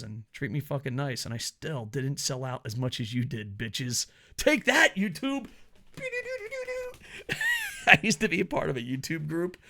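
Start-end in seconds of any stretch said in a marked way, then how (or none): 4.4-5.5 higher, louder triumphant jab; gleeful taunting yell. Real, not acted. Taunting YouTube. 6.0-7.8 very high squealing giggle; giddy high-pitched cackle. Real, not acted. Gleeful laugh after taunt.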